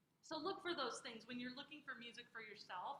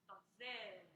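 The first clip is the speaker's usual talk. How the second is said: "Donzelle" with a long "ZEL", falling away after it; second-to-last syllable stressed; 4 semitones lower, slower than usual. Shown as a demonstration. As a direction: lean on the middle of "Donzelle". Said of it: "the one that's emphasized".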